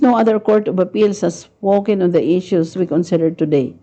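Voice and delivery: normal talking voice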